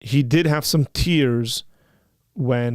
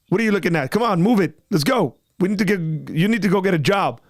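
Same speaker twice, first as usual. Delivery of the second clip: higher-pitched, punchy playful orders; mock demanding dad; 5 semitones higher, faster than usual. Dad telling his one-year-olds to get jobs, mock demanding. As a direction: hustle a toddler along in pretend exasperation.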